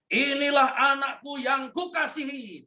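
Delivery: high, ringing heavenly declaration; God the Father proclaiming his beloved son; proclaiming Father's voice. Acted. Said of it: "identitas yang diberi Bapa begini".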